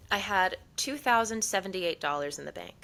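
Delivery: ordinary talk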